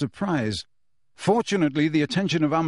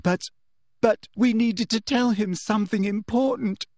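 high, plaintive stammered protest; wailing; 8 semitones higher than usual. A narrator's reading. Girl fakes anguish to teacher, wailing. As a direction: wail as if in tears. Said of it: "wailed Hermione".

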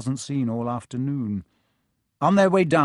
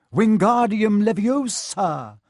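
precise spell; 7 semitones higher, 7 dB louder, slower than usual. Acted. Hermione casts the spell.

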